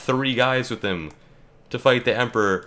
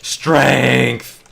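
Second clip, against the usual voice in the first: game callout; 3 semitones higher, 8 dB louder than usual. Real, not acted. Calling ability in game.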